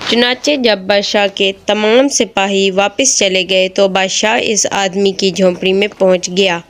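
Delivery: plain reading voice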